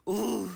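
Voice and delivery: very loud, high "ooh"; high whoop. Real, not acted. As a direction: whoop out loud between laughs. Whoops amid laughing fit.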